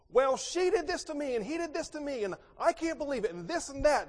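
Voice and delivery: put-on high, loud complaining kid voice; a kid rattling off grievances; whiny child. Child tattling to parent, high and whiny.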